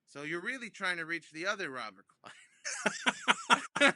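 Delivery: slow, laughing through the words; amused chuckle. Retold or imitated. Old comedian amused at the wrong-number mix-up, chuckling. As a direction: let the laugh break into the line.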